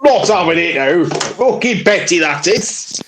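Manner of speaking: high, drawn-out Yorkshire grumble; old Yorkshire man. Acted. Mocking the petty council as an old Yorkshire man. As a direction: put on an outraged old Yorkshireman. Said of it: "he's an old Yorkshire man now".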